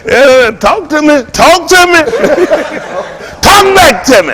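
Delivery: high, slow, teasing talk to me; playful call out. Acted. Teasing audience call and response.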